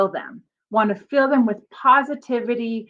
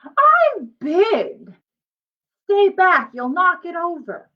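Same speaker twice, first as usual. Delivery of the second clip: put-on higher, louder bossy kid voice; bossy big brother; 6 semitones higher, 4 dB louder, slower than usual. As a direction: shoo the little sister off the tower. Felix shoos off little sister.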